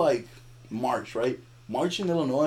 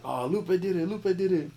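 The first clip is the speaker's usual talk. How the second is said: higher-pitched mock crowd chatter; imitating excited gossip; 7 semitones higher than usual. Storyteller mimics partygoers naming the culprit, excited gossip. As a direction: pass the rumor along in a raised, mimicking voice.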